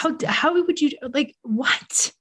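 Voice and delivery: high, sputtering disbelief; incredulous outrage. Retold or imitated. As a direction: re-enact being too indignant to finish. Acts out disbelief at airline staff.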